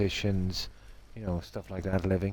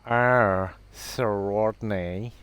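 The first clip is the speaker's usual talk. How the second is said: drawn-out, throaty posh impression; amateur posh film-star impersonation, very slow; Kenneth Williams; 4 dB louder, much slower than usual. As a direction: stretch it out like a snooty comic actor. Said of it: "I don't know if I can do his accent".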